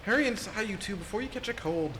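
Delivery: put-on high, lively country-accented grandma voice. Scolding the kids to come in, in a country accent. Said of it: "giving everybody the country accent".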